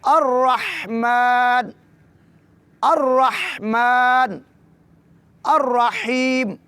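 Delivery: very slow الرحمن with the م stretched; slow recitation demo. Shown as a demonstration. Teacher showing how to read each word in full, slow chant. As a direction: draw out each word separately. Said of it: "تو مکمل کیسے پڑھیں گے؟".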